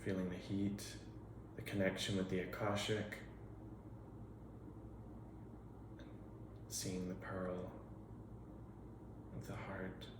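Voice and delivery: hushed invocation with long pauses between phrases; guided meditation. Real, not acted.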